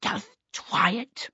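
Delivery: put-on slow, soft little-boy dare; daring child. A narrator's reading. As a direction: dare softly and slowly in a child's voice. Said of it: "Twinkle daring her to".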